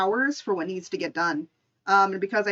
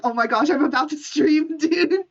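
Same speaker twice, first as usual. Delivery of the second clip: higher, louder, flustered and laughing; mock panic; 5 semitones higher, 4 dB louder than usual. Retelling her own reply to the boss with laughing mock panic. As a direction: play up the rush before going live. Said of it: "and I'm like".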